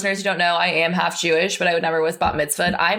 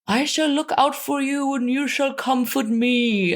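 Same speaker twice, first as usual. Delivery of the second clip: put-on higher, tender old-fashioned movie line; mock earnest; 7 semitones higher than usual. Quoting the blind man to Frankenstein's monster, mock earnest. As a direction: play it like a sincere old film. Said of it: "the blind guy's like".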